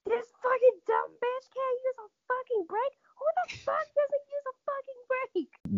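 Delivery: high, exasperated yelling; mock exasperated rant. Friend ranting at the screen about the brakes, exasperated. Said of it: "the entire time was like".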